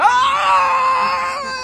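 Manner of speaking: high loud mock scream; astronaut scream. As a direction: shriek as the rocket fires. Astronaut presses the button.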